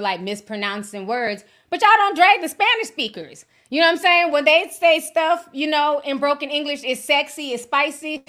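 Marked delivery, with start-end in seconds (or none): 1.7-8.2 higher, louder sassy protest; indignant complaint. Real, not acted. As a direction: call out the unfairness. Complaining about double standard.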